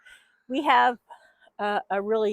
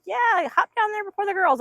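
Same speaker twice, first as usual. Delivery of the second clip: high squeaky rooster voice; voicing the rooster; 11 semitones higher, 4 dB louder than usual. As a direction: speak for him in a tiny high voice. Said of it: "He's like".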